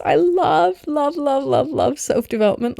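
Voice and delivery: real excited gushing; gushing love. Real, not acted. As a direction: gush over it, can't stop saying love. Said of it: "I'm so excited".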